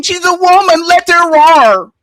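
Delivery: high, slow mocking sing-song; exaggerated cartoon intonation. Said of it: "cowering down and saying".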